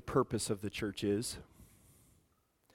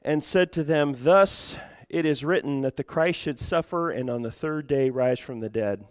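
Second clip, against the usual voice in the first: projected Bible reading, loud at first, then easing; scripture reading; 2 semitones higher, 6 dB louder than usual. Real, not acted. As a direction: proclaim the passage to the congregation. Reads scripture aloud to congregation.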